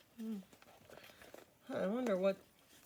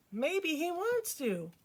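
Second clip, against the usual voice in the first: put-on higher and slow, gently hopeful girl; a lightly voiced hopeful tomboy; Peppermint Patty voice; 8 semitones higher, slower than usual. A narrator's reading.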